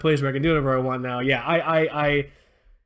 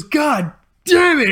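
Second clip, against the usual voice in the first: mock anger; 11 semitones higher, 7 dB louder, much slower than usual. Boss slamming table, retold.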